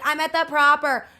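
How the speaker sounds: loud, high, slow drunk slur imitation; drunk girl. Imitating the drunk girl on his phone call, loud and high. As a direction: ham up a tipsy girl shouting over the phone. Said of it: "she's, like, obviously drinking".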